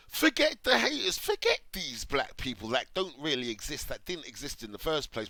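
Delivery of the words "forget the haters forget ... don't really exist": "Forget the haters" pitched very high and loud; scornful high mimicry of "Forget the haters"; mocking headline. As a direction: ham up the headline in a sneering high voice.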